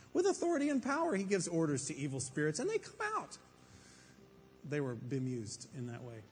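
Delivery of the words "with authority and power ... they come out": amazed crowd. A narrator's reading. Amazed crowd voiced in story.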